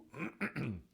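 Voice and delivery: brief chesty throat clear; rough little throat clear. Real, not acted. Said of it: "having to clear my throat sometimes".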